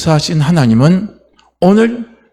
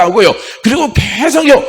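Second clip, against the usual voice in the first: pitched-up rallying cry to keep chanting; urgent high call; 7 semitones higher, 4 dB louder than usual. Real, not acted. Preacher urging the flock to keep chanting, high rallying call. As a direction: rouse the congregation, high and urgent.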